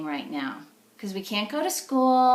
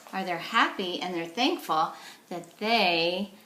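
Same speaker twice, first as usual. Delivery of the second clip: usual speaking voice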